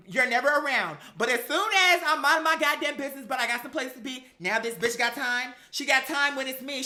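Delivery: ordinary talk, nothing marked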